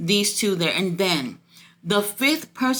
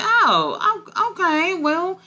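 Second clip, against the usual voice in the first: jumps much higher, caught off guard, then settles; startled surprise; 8 semitones higher than usual. Retold or imitated. Vlogger reacting to stepdaddy showing up, startled and much higher. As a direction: squeak out a surprised okay, then shrug it off. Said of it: "and I was just like".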